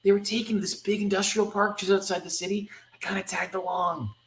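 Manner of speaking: put-on high-pitched girl character voice; a kid recounting events, pitched high; young Kitty. Girl explaining to her rescuer, young high voice.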